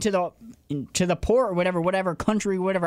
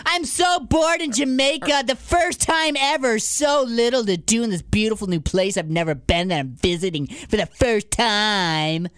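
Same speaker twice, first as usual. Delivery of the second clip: mocking complaint; 3 semitones higher than usual. Co-host mocks podcaster bored in Jamaica, complaining. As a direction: mimic a spoiled complainer, stretching the ending. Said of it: "your first world problems".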